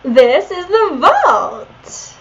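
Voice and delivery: put-on excited Valley Girl, drawn out. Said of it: "my Valley Girl voice".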